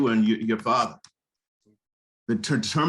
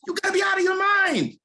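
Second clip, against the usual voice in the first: incredulous shout. Acted. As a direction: yell it like he's lost his mind. Friend scolding about jailbait.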